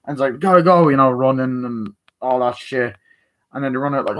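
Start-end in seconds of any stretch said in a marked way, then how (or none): 0.4-0.9 hurried shout. Retold or imitated. Hurried exit in retelling.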